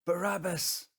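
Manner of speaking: loud, high cry of the name; high, forceful one-word shout; shouted crowd answer. Acted. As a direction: call it out as the crowd's demand. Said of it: "they answered".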